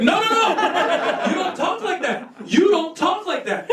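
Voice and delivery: high, laughing "no, no, no", drawn out; shocked laughing scold. Retold or imitated. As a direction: scold the kid through disbelieving laughter.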